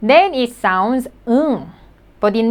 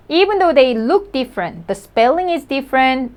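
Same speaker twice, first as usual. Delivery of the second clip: ordinary talk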